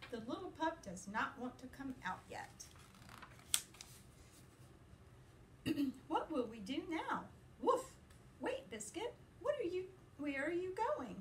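lively, sing-song picture-book reading; reading to children. A narrator's reading. Teacher reading a puppy book to her class, playful and lively. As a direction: read it brightly to little listeners.

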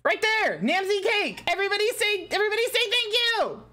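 high-pitched ecstatic cheering, stretched-out words; elated shouting. Real, not acted. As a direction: cheer at the top of your range. Ecstatic celebrating with chat.

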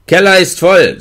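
höher und lauter gerufen; Ruf. A narrator's reading. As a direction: lass es wie Hilferuf klingen. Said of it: "aber ich machte". (laut gerufen, wie ein Hilferuf)

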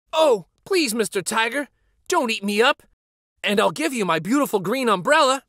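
put-on high, hurried pleading little boy; frightened pleading. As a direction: rush out the offer in a panic. Samba begs second tiger.

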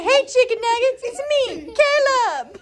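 put-on high excited shout for the baby; excited demo line. Shown as a demonstration.

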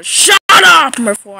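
sudden loud threatening yell; scream. Acted. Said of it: "I've never heard you scream before".

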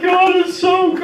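very high mock-crying wail; hammed-up happy sobbing; imitated bawling. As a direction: wail it tearfully, far above your normal pitch. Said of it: "walking away just bawling, crying".

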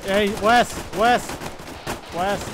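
loud high shout of warning; alarm shout. Real, not acted. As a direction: bellow the direction at the squad. Calling out enemy direction.

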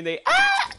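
very high loud mock shriek; hammed-up child rage scream; kid scream. Mocking a kid rage-quitting, very high mock shriek.

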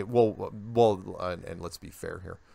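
ordinary talk, nothing marked

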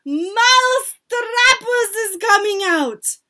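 high, full-voiced proclamation; witch's loud shout. A narrator's reading. Witch announces mousetraps to her followers, shouting loudly. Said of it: "raised her voice louder than ever and shouted".

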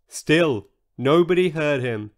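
ordinary delivery, nothing marked

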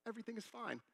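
high, soft, breezy doctor voice; nonchalant doctor. Retold or imitated. Doctor reassuring a frantic father, nonchalant. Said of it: "so relaxed and nonchalant".

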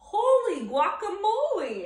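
high, loud startled cry; surprised exclamation. A narrator's reading. Kitten startled mid-bounce, a high loud exclamation. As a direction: shout it out in surprise. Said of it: "exclaimed Skippy John Jones".

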